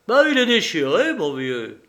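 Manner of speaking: high, loud teasing; mock him about the warrant. A narrator's reading. Mocking reveal of torn warrant.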